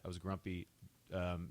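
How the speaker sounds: ordinary talk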